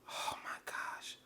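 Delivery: slow, hushed "oh my gosh"; whispered dismay. Retold or imitated. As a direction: whisper it slowly, imitating a dismayed manager. Practice manager's dismayed aside, acted.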